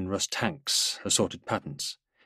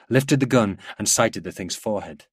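ordinary delivery

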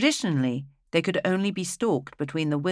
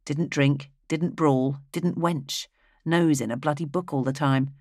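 ordinary delivery

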